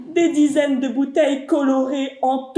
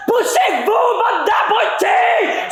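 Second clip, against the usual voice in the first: angry shout; 8 semitones higher, slower than usual. Acted. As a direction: bellow at the crowd as you race through. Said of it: "Je crie".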